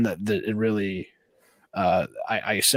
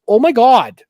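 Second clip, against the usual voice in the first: much higher, louder shocked cry; shocked gasp; 11 semitones higher, 13 dB louder, slower than usual. Retold or imitated. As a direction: blurt it out in disbelief. Wife shocked at cage fall.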